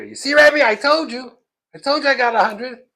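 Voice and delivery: high, loud schoolboy gloating; beaming triumph. Retold or imitated. Pupil proving his score to rebbe, triumphant. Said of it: "Kid is beaming from ear to ear".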